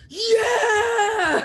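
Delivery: high, loud whoop; wild cheer. Acted. Shocked drinkers cheering the finished giant drink, wild high cheer. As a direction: cheer like a rowdy drinking table.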